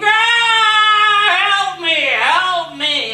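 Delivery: high, loud yelling dwarf; dwarf crying for help. Acted. Trapped dwarf calls to the girls, loud high cry. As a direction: wail for help like a trapped little man.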